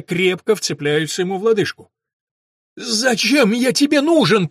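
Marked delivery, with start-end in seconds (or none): 2.8-4.5 high, loud, slow pained moan. A narrator's reading. Dan pinned down with hurt elbow, moaning in pain. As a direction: groan it out through pain. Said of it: "простонал Дэн".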